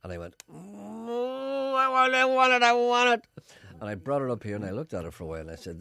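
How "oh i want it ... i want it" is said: put-on high, loud childish craving; greedy whine. Wanting the apple puff, a high loud whining plea.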